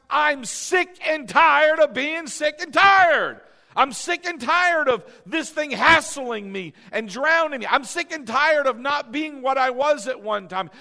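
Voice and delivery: loud, high, impassioned angry outburst; mad at life. Acted. Preacher acting out getting mad at life, angry shouting. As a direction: shout it like someone who has had enough. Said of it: "you sometimes have to get mad at life".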